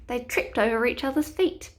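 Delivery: ordinary delivery, nothing marked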